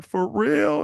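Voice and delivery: high, incredulous mock question; gullible boyfriend. Boyfriend reacts to her confession, mock amazement. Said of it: "And you're like".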